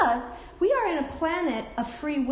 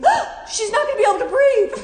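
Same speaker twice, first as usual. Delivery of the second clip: high, loud, fast mock alarm through laughter; mock worried gasp, laughing; 13 semitones higher, 10 dB louder, faster than usual. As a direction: blurt it out in joking panic. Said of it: "Tony goes".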